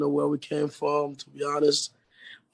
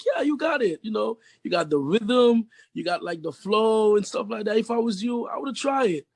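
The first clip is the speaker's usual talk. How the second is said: quoting his teacher; 7 semitones higher than usual. Retold or imitated. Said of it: "He'd be like".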